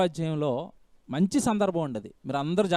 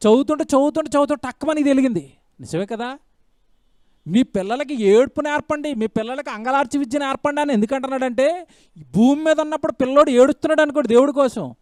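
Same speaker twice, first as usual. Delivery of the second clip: higher, louder, fervent delivery; full-force preaching; 5 semitones higher, 5 dB louder than usual. Acted. Preacher urging parents to teach children to weep for God. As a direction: preach it with fire.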